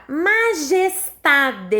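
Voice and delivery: "majestade" in four marked beats, ma-jes-ta-de; sílabas destacadas. Shown as a demonstration. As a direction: pronounce "majestade" syllable by syllable. Teacher enunciates example word. (majestade com cada sílaba marcada)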